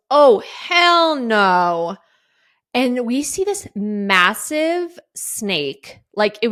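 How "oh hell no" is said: drawn-out refusal. Retold or imitated. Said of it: "my friend Mel goes".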